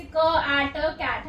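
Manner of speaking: "क, आ, ट" sound by sound, then joined as "कैट". Shown as a demonstration. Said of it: "word अच्छे से read". ("क, आ, ट" अलग-अलग, फिर "कैट" जोड़कर)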